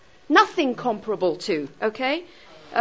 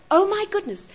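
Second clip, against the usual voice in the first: higher-pitched mock surprise; sudden realization; 7 semitones higher than usual. Teacher voicing students who suddenly realize, mock surprise. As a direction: act out the penny dropping. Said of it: "you suddenly realize".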